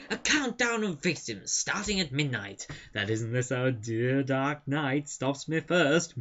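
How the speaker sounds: hammed-up villain making death threats; evil showman. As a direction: deliver theatrical threats, drawing out the ending. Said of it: "he's making all these death threats like that".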